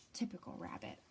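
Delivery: dry whispered aside. A narrator's reading. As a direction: whisper it with a sigh.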